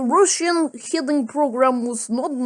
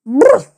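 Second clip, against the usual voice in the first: loud mock sneeze burst, higher and much louder; retold sneeze; 7 semitones higher, 13 dB louder than usual. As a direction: blurt out a hammed-up sneeze. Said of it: "but then he sneezes".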